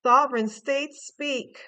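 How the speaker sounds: "Sovereign" hit loud, slow and proclaiming; proclamation. Pretend. Tarot reader proclaims the card's words like a herald, loud. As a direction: announce "Sovereign states speak" like a royal decree. Reading a card's message aloud.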